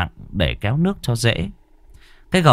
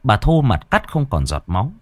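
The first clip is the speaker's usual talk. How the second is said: ordinary delivery, nothing marked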